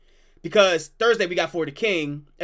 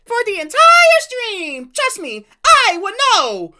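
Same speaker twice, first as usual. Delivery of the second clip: high, slow, loud cartoon shout; 12 semitones higher, 7 dB louder, slower than usual. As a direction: yell back in a squeaky cartoon voice. Answering the character in a voice.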